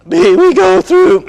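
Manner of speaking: cracking puberty voice. Shown as a demonstration. Mimicking a boy's voice breaking.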